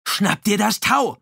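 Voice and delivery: loud, high, slow shouted order; calling out. A narrator's reading. Dominique yells to his brother to grab the rope, loud high call. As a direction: bellow it over the gunfire. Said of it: "rief Dominique".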